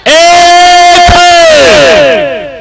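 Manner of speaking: high, long-held cry; drawn-out proclamation. Real, not acted. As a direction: stretch it out high like a ringing proclamation. Preacher proclaims the one master. (ऊँचे सुर में लंबा खींचा ऐलान)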